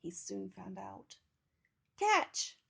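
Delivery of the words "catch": high, loud call; boy's yell. A narrator's reading. Boy tossing Teddy to the girl, yelling. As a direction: yell it to your friend, much higher and louder. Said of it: "the boy yelled".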